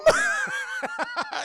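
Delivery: real loud squealing laughter; high laugh. Real, not acted. Reviewer cracking up at Peter's amazement, loud high laugh. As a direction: crack up helplessly.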